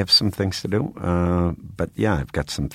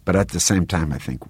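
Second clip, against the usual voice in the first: ordinary delivery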